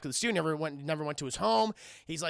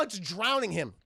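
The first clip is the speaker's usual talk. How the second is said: ordinary delivery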